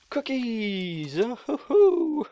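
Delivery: drawn-out, giddy exclamation; delighted squeal. Real, not acted. Gamer finds creeper laid cookies, delighted squeal. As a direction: coo it out with glee.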